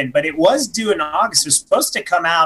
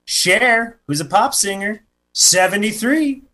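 ordinary talk, nothing marked

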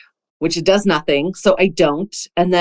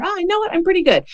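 put-on much higher, light child's voice; breezy kid voice; 12 semitones higher, much faster than usual. Stepmom imitating her stepkid politely declining, much higher and light. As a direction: brush off the cookie like a contented kid.

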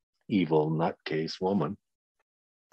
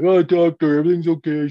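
higher, louder mock cheerful voice; mock Jason reassurance; 9 semitones higher, 8 dB louder, much faster than usual. Father mimicking Jason announcing everything's okay, high and bright. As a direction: play Jason chirping that all is fine.

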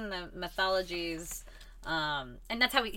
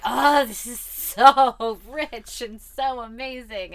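loud, high, drawn-out gush with a laugh; gushing delight; 6 semitones higher, 12 dB louder, slower than usual. Retold or imitated. A reader reacting to all these mythology pieces, gushing. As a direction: gush slowly, stretching the words in delight.

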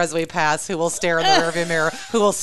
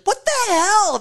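high exasperated burst; mock outrage; much slower than usual. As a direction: snap at a slow driver. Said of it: "whenever you're like".

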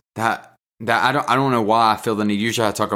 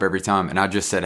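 normal talking voice

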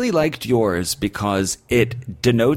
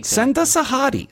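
excited plea; 13 semitones higher than usual. Pretend. Pleading to aliens for hotties.

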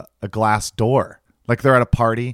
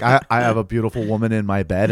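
ordinary talk

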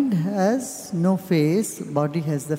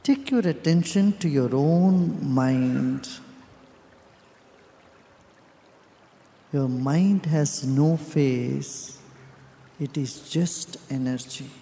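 guided meditation; 4 semitones lower than usual. Real, not acted. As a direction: guide them inward, calm and unhurried, with long pauses.

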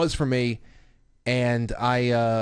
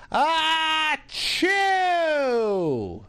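high, drawn-out atomic sneeze; toddler fake sneeze. Toddler resisting a diaper change with a fake sneeze, high and long. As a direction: sneeze big to stop the grown-ups. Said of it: "She goes".

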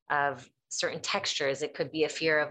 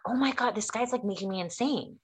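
exasperated; 6 semitones higher than usual. Retold or imitated. Exasperated by coworker's repeated questions.

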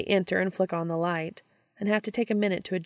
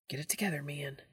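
hushed urgent whisper; 3 semitones lower, 9 dB quieter, faster than usual. Acted. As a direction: hiss it under your breath. Hushed in dark library.

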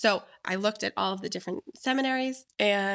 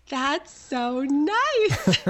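high, drawn-out, louder gushing; delighted admiration; 6 semitones higher, 6 dB louder, much slower than usual. Retold or imitated. Hostess getting a kick out of guest's shopping, delighted gushing. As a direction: gush it slowly, laughing with delight. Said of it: "clearly getting such a kick out of it".

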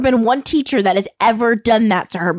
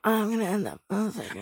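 resigned aside; 4 semitones lower, 12 dB quieter, faster than usual. Retold or imitated.

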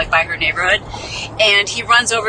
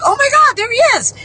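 much higher, louder, thrilled; excited relief; 4 dB louder than usual. Retold or imitated. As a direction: squeal as the hero shows up. Rescuer returns with gas.

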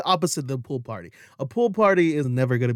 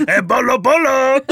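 loud, high-pitched cartoonish call; Pee Wee Herman; 10 semitones higher, 9 dB louder than usual. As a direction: shout it high and goofy, hammed-up. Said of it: "He's Pee Wee Herman".

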